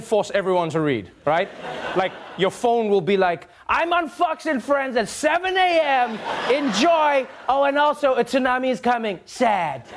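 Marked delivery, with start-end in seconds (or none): 3.7-9.8 mock Trump tweet, pitched up; a lightly hammed-up Trump voice reading his own alert; Trump-style alert.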